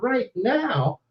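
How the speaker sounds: high, soft, drawn-out disbelief; hushed high-pitched astonishment; stunned echo. Retold or imitated. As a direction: echo it slowly, not believing it. Echoes reporter in disbelief.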